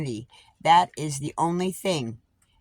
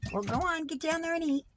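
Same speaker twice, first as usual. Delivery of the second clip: pet talk; 11 semitones higher, 5 dB quieter, faster than usual. Real, not acted. To her birds, coaxing them down to eat, in a high pet voice. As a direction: sweet-talk the birds down to their food.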